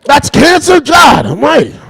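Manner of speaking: high, loud hammed-up shout; shocked disbelief. Obama shown cancer in a jar, hammed-up shock. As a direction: cry out aghast, way up in pitch.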